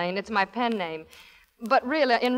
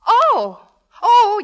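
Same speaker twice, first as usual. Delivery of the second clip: high, loud flustered gasp of alarm; panicked realisation, voice shooting up; 11 semitones higher, 11 dB louder than usual. Acted. Elizabeth alarmed to learn the baby's bath is due, high and loud. Told she must bathe the baby.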